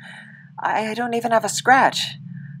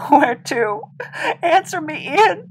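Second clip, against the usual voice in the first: rising, higher desperate shout; 8 semitones higher, 4 dB louder than usual. Acted. To dying Ian, begging him to answer: desperate shout. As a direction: yell for him to answer, voice climbing.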